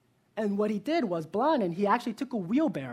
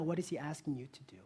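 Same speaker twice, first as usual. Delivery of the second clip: hushed, quick whisper; breathy earnest whisper to the congregation; whispered question; 3 semitones lower, 9 dB quieter, faster than usual. Real, not acted. Pastor's soft closing challenge.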